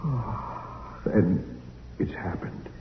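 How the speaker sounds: barely holding back tears; overwhelmed. Acted. Lonely survivor meets the first people in years, slow and moved. Hermit overwhelmed meeting humans.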